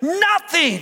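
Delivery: carried away by joy; excited preaching. Real, not acted. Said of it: "I'm getting a little excited".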